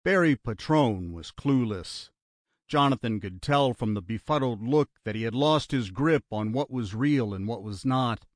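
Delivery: plain reading voice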